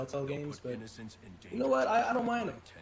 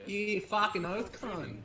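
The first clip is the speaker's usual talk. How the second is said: slow, higher hammed-up Australian slang; over-the-top Australian accent; 5 semitones higher, slower than usual. Streamer hamming up Aussie slang for the chat, slow exaggerated Australian accent. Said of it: "Here's the thing about the Australian accent".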